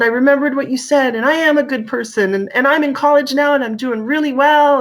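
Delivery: put-on high, loud eager young man; proud rush of good news; excited youth. Young man at the desk telling the officer, excited. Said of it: "he was so excited".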